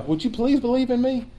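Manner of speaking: high, soft, timid asking; mock pleading request. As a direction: ask meekly, as if begging a favor. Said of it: "This isn't a request".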